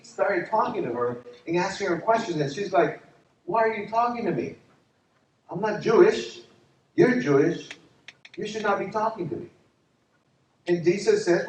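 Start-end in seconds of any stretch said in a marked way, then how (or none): 3.4-9.5 higher, challenging questioner; puzzled Samaritan woman. Retold or imitated. Preacher as Samaritan woman questioning Jesus, higher puzzled protest. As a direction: voice her objection, pitched up.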